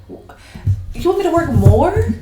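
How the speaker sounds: zdziwiony, wyższy i głośniejszy, wolniej; mock outrage. Mock boss protest.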